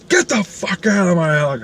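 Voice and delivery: high-pitched mock-angry order; acted-out irate vendor; angry dismissal. Vendor throws him out, slams door.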